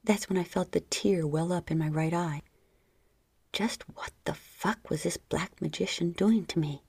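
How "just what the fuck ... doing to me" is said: plain reading voice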